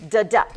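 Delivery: "da-da" with the weight on the second syllable; weak-STRONG "da-da"; iambic stress. Shown as a demonstration. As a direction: lean on the second half of "da-da". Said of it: "that's how you mark".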